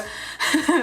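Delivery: breathy giggle. Real, not acted. Keeps laughing, embarrassed.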